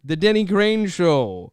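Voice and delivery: booming high announcer voice, slowed; show announcer. Pretend. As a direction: announce the show big and loud.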